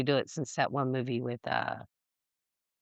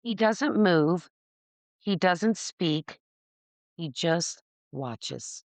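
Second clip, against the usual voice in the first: spooky storytelling; slower than usual. Acted. Spooky story line read.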